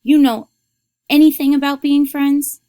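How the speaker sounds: loud, high-pitched angry yell; indignant girl yelling at her mother. A narrator's reading. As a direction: shout it back, hurt and defiant. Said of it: "Then she yelled".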